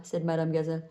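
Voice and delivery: ordinary delivery, nothing marked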